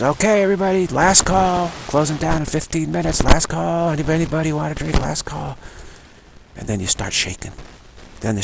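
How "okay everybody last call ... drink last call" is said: higher, carrying bartender's last call. Retold or imitated. Bartender announcing last call to the bar, calling out high. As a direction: sing out last call to the whole room. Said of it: "And the bartender says".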